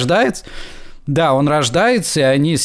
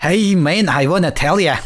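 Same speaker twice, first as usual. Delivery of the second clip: put-on Irish accent; 3 semitones higher than usual. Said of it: "это уже ирландец уже какой-то".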